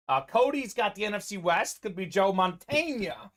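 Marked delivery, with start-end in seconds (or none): 2.3-3.3 "Montana" said as mon-TAIN-ya; playful mispronunciation. Retold or imitated. Teasing co-host Mike with his way of saying it, playful mispronunciation. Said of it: "as you like to say".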